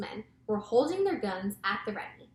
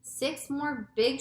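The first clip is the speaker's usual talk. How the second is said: ordinary delivery, nothing marked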